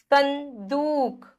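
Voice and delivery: संदूक slowly, in two parts; सं, then a long दूक; nasal n sound. Shown as a demonstration. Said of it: "न की ध्वनि आ रही है".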